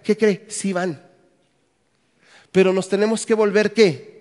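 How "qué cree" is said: normal talking voice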